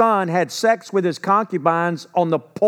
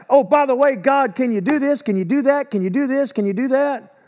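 offhand demanding prayer; 8 semitones higher, faster than usual. Retold or imitated. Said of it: "But to just like".

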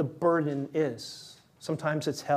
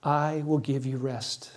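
quiet promise; 2 semitones lower, 3 dB quieter than usual. Acted. Quiet slow quote.

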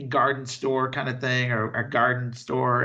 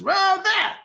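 much higher, louder mock enthusiasm; mock excited reveal; 5 dB louder than usual. Co-host imitating Larry turning round to point, mock excited reveal. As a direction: exclaim it high, as if thrilled.

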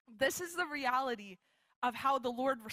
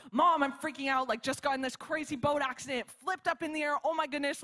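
higher-pitched mock panic; retold freak-out; 4 semitones higher than usual. Calling Mom after a dream boat crash, acted panic. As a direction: act out freaking out on the phone. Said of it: "like, freaking out".

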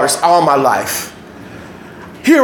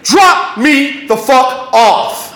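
slow, higher shout; forceful demand; 5 semitones higher, much slower than usual. Retold or imitated. Kid pointing gun at gang repeats demand, forceful shout. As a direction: shout each word separately and hold firm.

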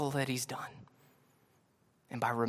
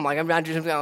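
ordinary talk

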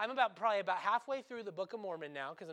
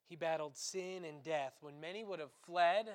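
ordinary talk, nothing marked

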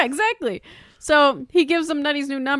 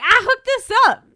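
high, loud imitation of outrage; mock indignant protest; 10 semitones higher, 5 dB louder than usual. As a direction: object loudly, pitch way up. Retold protest at being kicked out.

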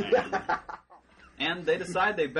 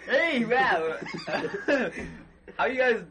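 laid-back Tom Bombadil; 8 semitones higher, 5 dB louder than usual. Tom Bombadil greeting hobbits in trouble, laid-back and loud. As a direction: greet them like a mellow surfer dude. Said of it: "Old Tom Bombadil lives America".

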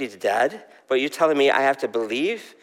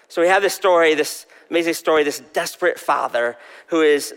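ordinary delivery

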